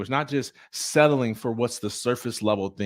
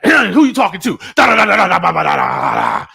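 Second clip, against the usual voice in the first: high, loud angry ranting into babble; mock angry outburst; 8 semitones higher, 11 dB louder than usual. Husband retelling how he snapped at his wife, mock angry shouting. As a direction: blow up at your wife, then rant in nonsense syllables. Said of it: "I would respond with anger".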